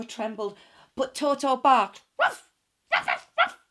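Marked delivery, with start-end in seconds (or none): 2.2-3.6 very high, loud little yaps; yappy dog barks. Acted. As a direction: bark like a small dog. Toto barking.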